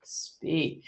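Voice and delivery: soft, drawn-out inner voice command; voice in head. Retold or imitated. Medium recounting the voice in her head, soft command. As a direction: imitate a quiet voice heard in the head. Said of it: "I hear a voice in my head saying".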